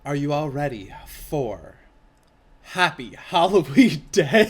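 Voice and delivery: hype announcer. Pretend.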